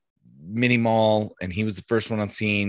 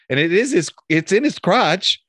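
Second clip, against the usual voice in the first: building up higher toward the punchline; mounting glee, higher and louder; rising excitement; 9 semitones higher, 5 dB louder than usual. Real, not acted. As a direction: push up toward the laugh.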